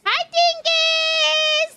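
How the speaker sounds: high, long drawn-out hello; sing-song greeting. Real, not acted. Streamer greeting a viewer in chat, high drawn-out hello.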